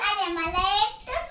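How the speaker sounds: ordinary talk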